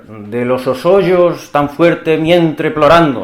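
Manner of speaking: put-on slow, old Castilian pronunciation of "de los osollos"; medieval Spanish reading. Shown as a demonstration. Lecturer reading the Cantar line to students, slow archaic pronunciation. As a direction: read "de los osollos" slowly, the way the Cantar was first said. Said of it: "tendremos que leer".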